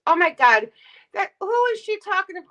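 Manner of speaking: loud, startled oh my God; shocked outburst. Real, not acted. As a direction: blurt it out in disbelief. Reacting to a chat comment.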